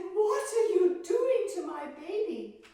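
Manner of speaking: high, loud panicked cry; a mother snatching her child in terror; frantic mother. Acted. Queen Metanira to Demeter, a high, loud, panicked cry. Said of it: "And she said".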